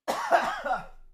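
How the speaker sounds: hard choking cough; high strangled cough; real coughing fit. Real, not acted. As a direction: cough out coffee gone down the wrong way. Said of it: "coffee went down the wrong way".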